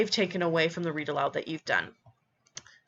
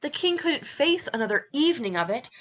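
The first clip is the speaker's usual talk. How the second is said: higher, animated read-aloud line; storybook narration; 7 semitones higher than usual. Acted. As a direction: build up to the king's outburst. Reads picture book aloud.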